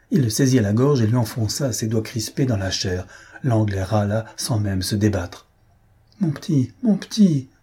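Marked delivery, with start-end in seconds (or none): 6.2-7.5 pleading. A narrator's reading. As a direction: beg him to let go. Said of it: "supplia Victoire". (Victoire supplie Lupin, en montant)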